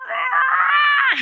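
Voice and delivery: sudden high yelp of alarm; startled shout. Retold or imitated. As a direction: jolt awake with a yelp. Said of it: "you're like".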